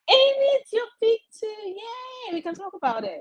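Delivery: high, bright squeal of delight; thrilled, voice leaping way up; excited. Real, not acted. Excited about shared book pick.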